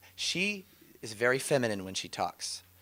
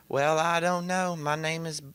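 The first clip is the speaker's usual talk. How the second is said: put-on Southern accent; 5 semitones higher than usual. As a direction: imitate a woman drawling in a Southern accent. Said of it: "talking in a Southern accent".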